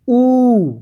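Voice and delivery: ऊ held with rounded lips; vowel demo. Shown as a demonstration. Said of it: "बोलिए आप लोग".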